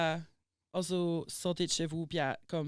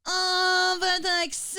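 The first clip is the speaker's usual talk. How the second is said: much higher, louder mock gushing over an accent; condescending gush; 13 semitones higher, 11 dB louder, slower than usual. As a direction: ham up a patronizing fan. Imite un interlocuteur condescendant. (imitation condescendante, beaucoup plus aiguë et forte)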